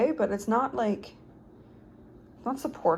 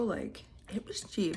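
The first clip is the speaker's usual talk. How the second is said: whispering; 10 dB quieter, slower than usual. Real, not acted. Whispered aside.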